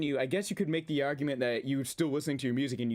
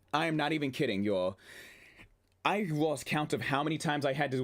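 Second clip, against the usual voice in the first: usual speaking voice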